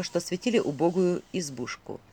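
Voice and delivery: ordinary delivery, nothing marked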